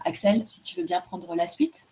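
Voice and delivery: ordinary delivery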